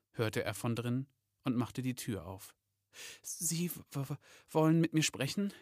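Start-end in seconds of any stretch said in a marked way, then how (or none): 3.2-5.5 higher voice, stuttering on the first words; frightened stammer. Acted. Elf summoned to Santa's office, frightened stammer. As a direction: stammer nervously, catching on the consonants. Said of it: "stotterte Packet vor Angst".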